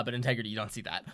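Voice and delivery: ordinary delivery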